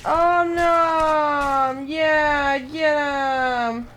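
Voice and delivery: loud gleeful monster cry; excited shout. Pretend. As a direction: shout it out with glee.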